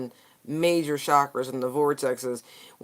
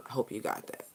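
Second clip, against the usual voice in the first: whisper; 4 semitones lower, 12 dB quieter, faster than usual. Real, not acted. Whispered closing aside.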